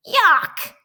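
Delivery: high, loud disgusted squeal; squealed yuck. A narrator's reading. Pigs shriek at the snotty sneeze, high and loud. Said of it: "squealed the little pigs".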